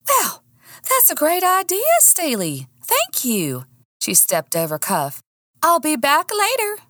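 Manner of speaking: high bright woman's voice, pitched well up; chirpy woman customer. Acted. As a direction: lift it up like a cheerful lady.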